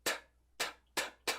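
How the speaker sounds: bare voiceless "t" clicks of air; isolated T sound. Shown as a demonstration. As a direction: say each "t" alone, soft, with no vowel after it. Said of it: "qual que é o som do T?". ("t" isolado, só o ar, sem vogal)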